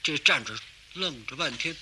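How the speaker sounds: normal talking voice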